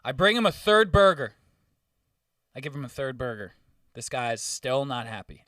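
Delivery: usual speaking voice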